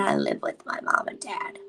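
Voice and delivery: low whisper; hushed confession; whispered aside. Real, not acted. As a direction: whisper it like a secret. Confiding aside.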